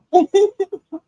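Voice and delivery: laughing while trying not to cry; high laugh that breaks out mid-talk. Real, not acted. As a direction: burst of high-pitched laughter. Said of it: "I'm laughing".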